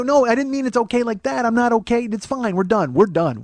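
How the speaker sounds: loud, high, rattled insistence; high-pitched flustered backpedal; flustered high protest. Retold or imitated. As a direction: babble it out in a panic.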